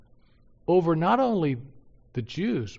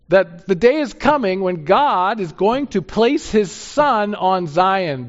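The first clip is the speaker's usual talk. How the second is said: loud, high, impassioned preaching; fiery preaching; 8 semitones higher, 8 dB louder than usual. Acted. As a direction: preach it out with rising fire. Preaching at full force.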